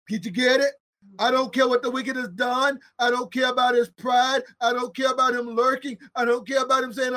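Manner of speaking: normal talking voice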